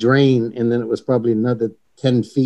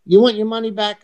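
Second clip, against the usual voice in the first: a light, higher-pitched imitation of an English accent; 9 semitones higher than usual. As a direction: tease him in a lightly mocked English accent. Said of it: "his perfect English accent".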